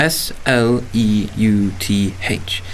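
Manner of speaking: each letter of S L E U T H said separately, slowly; spelled out. Acted. Lodger spelling his name for the landlady, slow and deliberate.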